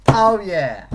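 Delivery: loud, high happy whoop; excited cheer. Real, not acted. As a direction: celebrate out loud. Celebrating the win.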